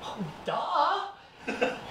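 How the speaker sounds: mock teenage "duh", half laughing; sarcastic kid. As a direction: brush off dad's obvious advice. Son's reply to dad.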